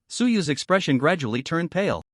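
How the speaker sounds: ordinary delivery, nothing marked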